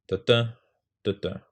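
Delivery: da-da, first da up, second falls away; rhythm syllables. Shown as a demonstration. Teacher sounding out a stressed rhythm. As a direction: tap out da-da like a beat.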